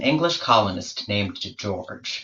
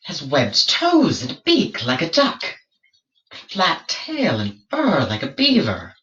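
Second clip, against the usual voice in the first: peer at the beast in a raised, eager voice; curious explorer; 3 semitones higher than usual. Acted. George studies the strange creature.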